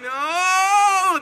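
long, high mock scream; hammed-up horrified scream. Burial crew teased by people lying on the ground, mock scream. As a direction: wail it out in comic horror.

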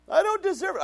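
high, loud protest. Retold or imitated. Pastor protests God's blessing, high and loud. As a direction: wail that he doesn't deserve it.

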